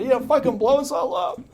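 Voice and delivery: smoker's voice. Retold or imitated. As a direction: voice a chain-smoking sponsor. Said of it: "she had this smoker's voice".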